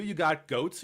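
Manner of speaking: ordinary talk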